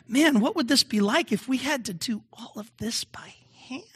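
hushed unvoiced inner voice; whispered thought. Preacher whispering his thought to the congregation.

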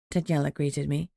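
ordinary delivery, nothing marked

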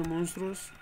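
ordinary delivery

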